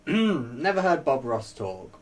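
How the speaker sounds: ordinary delivery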